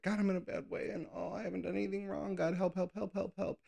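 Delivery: high whiny wail for help; high mock plea, help repeated; mock desperate cry. Mock crying out to God.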